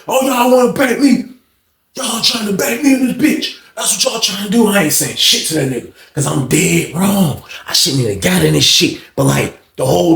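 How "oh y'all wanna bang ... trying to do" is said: high-pitched, indignant cry; retold outraged protest. Inmate protesting being jumped, high outraged cry. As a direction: act out protesting a jumping, pitched way up.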